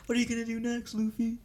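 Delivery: high timid sidekick voice. Acted. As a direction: ask it small and high, meekly. Timid sidekick questions the hero.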